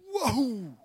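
loud, high, startled exclamation; river's startled whoa. Acted. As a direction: burst out in amazement as the river. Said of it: "the Jordan River goes".

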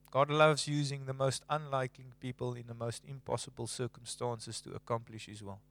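soft, low preaching that fades; quiet sermon refrain. Acted. Preacher to congregation, softly repeating the theme. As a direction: let it fall to a hush.

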